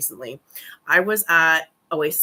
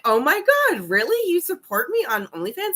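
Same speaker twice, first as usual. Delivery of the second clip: high-pitched, starstruck squeal of disbelief; gushing fangirl; 10 semitones higher than usual. Retold or imitated. Vlogger reenacting meeting a subscriber, gushing fangirl. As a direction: gush like a thrilled fangirl, pitch way up. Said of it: "almost a fangirl on him".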